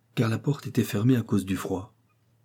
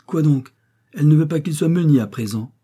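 higher, louder indignant outburst; furious peasant wife; outraged cry; 7 semitones higher, 9 dB louder than usual. A narrator's reading. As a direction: protest at full voice. Victoire outraged at the news.

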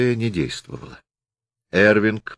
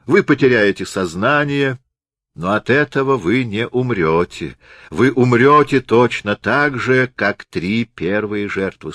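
crazed doctor; 2 semitones higher than usual. Acted. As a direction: savour each threat like a madman. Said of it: "Доктор рассмеялся безумным смехом".

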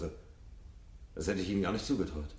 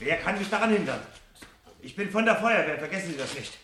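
defiant; 12 semitones higher than usual. Acted. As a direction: throw his authority in his face. Fireman defies the pleading professor.

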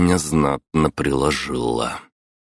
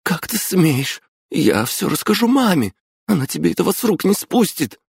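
put-on much higher, indignant girl's voice; a young girl threatening to tell her mother; 3 dB louder than usual. A narrator's reading. Girl scolds her bully brother, high and indignant.

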